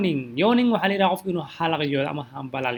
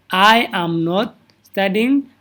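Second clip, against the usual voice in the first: each word spaced out slowly; slow, careful classroom model; slow model sentence; 3 dB louder, much slower than usual. Shown as a demonstration. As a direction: say it slowly so students can copy.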